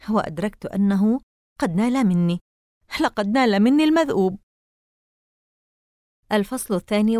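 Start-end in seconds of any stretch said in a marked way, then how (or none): 1.6-4.4 terrified. A narrator's reading. Caught by the monster.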